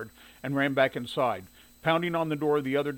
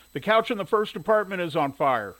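higher, louder urgent alert; urgent report; 5 semitones higher, 5 dB louder than usual. A narrator's reading. Boy alerting the first fireman, urgently.

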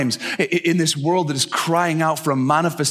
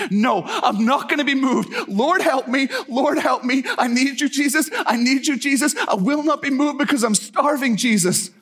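high-pitched, insistent imitated plea; desperate pleading; 11 semitones higher than usual. Kneeling woman to Jesus, desperate high pleading. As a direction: beg high and urgently, refusing to budge.

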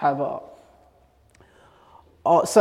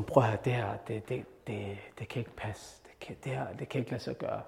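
low, very soft stunned murmur; thinking it aloud to herself, barely heard; inner thought; 7 semitones lower, 13 dB quieter than usual. Retold or imitated. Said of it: "så tænker hun".

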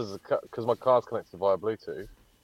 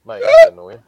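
much higher and louder, exasperated; annoyed outburst; 18 dB louder than usual. Real, not acted. Host fed up with his car's Bluetooth, loud and high. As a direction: squeal out the frustration. Frustrated with car tech.

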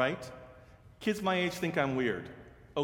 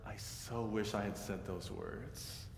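regretful; 5 semitones lower, 10 dB quieter than usual. Retold or imitated. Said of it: "She said".

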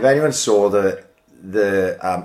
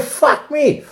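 shocked. Retold or imitated. Reacting to the donation total, high and surprised. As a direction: gasp it out in disbelief.

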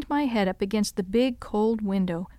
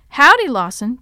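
hearty howdy; 3 semitones higher, 9 dB louder than usual. A narrator's reading. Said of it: "Josiah said".

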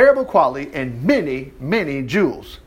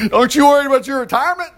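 laughing taunt; 7 semitones higher, faster than usual. Acted. Mock question, laughing.

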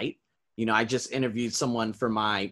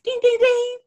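much higher, louder sung dings; mock bell ding; 7 dB louder than usual. Teacher cheers inside, with a high mock bell ding. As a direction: ring it out like a game-show bell. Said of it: "I'm just like".

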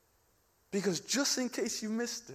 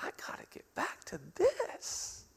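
strained inner aside; 7 semitones higher, 8 dB quieter than usual. Retold or imitated. His strained inner thought while she talks.

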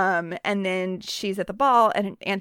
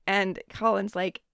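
ordinary talk

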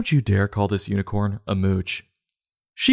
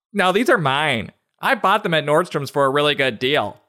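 high-pitched put-on denial; defensive borrower; 6 semitones higher than usual. Friend denying she borrowed the boots, high and defensive. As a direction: insist it high and quick to excuse yourself.

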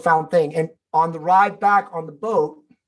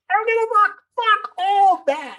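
much higher, pitched-up scoff at the team's worries; defiant outburst. Retold or imitated. Telling his worried team he doesn't care, high defiant outburst. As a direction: toss their worries away with a high-pitched mock scoff.

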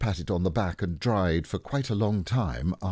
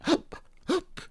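tiny high-pitched hiccups; squeaky little hiccup slipping out; accidental hiccups. A narrator's reading. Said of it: "said Roo accidentally".

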